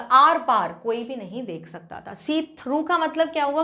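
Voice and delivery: normal talking voice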